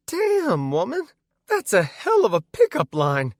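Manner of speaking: very high, loud falsetto. Acted. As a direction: squeal it in a disco falsetto. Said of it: "I said in a voice resembling one of the Bee Gees".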